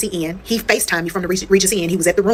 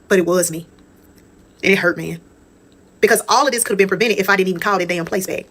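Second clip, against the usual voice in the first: ordinary talk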